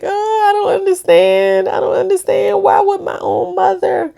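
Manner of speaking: high, sobbing plea, voice breaking; crying like crazy. Retold or imitated. Telling a friend about her mother, sobbing high. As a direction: cry out the question as if it hurts. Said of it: "I was crying like crazy".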